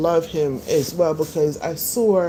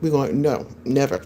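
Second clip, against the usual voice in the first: ordinary talk, nothing marked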